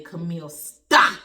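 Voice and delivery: loud, slow stop to herself; loud slow self command. Retold or imitated. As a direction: snap herself out of it. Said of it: "I had to say".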